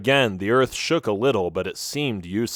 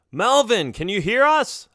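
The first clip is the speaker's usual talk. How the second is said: high, raised call through a barrier; yelling; 11 semitones higher than usual. Acted. Boy calls to Melvin past the rocks, yelling. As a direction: shout it up high to reach him. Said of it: "I yelled".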